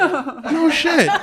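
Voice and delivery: high, laughing "no shit"; mock surprise. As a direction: act amazed with a laugh. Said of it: "And I'm like".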